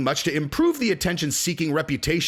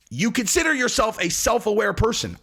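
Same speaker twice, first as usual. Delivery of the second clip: incredulous; 6 semitones higher than usual. Acted. Incredulous questioning.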